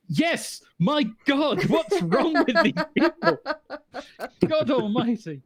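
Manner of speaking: high, slow, laughing disbelief; amazed foreigner. Retold or imitated. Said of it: "the foreigner just kinda goes".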